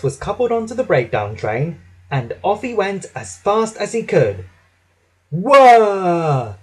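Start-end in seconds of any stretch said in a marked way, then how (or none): 5.3-6.6 loud, long falling whirr; whirring wheels. A narrator's reading. Thomas rushing to the rescue, imitated wheel whirr. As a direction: imitate wheels speeding along rails. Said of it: "went his wheels along the track".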